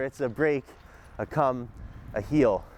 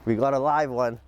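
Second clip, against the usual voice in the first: ordinary talk, nothing marked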